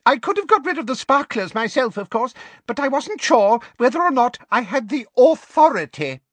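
very high, loud squeaky voice; Flitwick squeaky voice. A narrator's reading. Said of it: "in his squeaky little voice".

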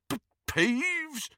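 high stammering baffled caretaker. A narrator's reading. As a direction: stumble on the name in confusion. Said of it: "stammered Filch".

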